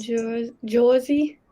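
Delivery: Jersey accent, "Jersey" said as "Joisey"; slow, tentative Jersey accent. Shown as a demonstration. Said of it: "Give us a Jersey accent".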